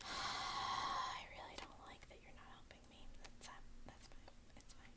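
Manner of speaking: breathy whispered grumble; whispering. Real, not acted. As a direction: mutter it under your breath. Mutters complaint to herself.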